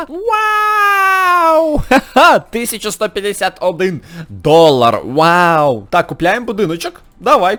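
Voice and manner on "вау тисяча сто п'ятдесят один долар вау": high, loud wow of delight; real joyful cry; thrilled shout. Real, not acted. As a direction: shout it in amazement. Big money reward.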